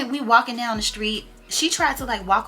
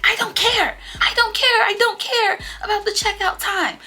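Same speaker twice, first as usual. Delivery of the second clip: high, loud mock yell; imitating a yelling friend; 12 semitones higher, 6 dB louder than usual. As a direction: shriek it like the upset friend. Friend Coco quoted in quarrel.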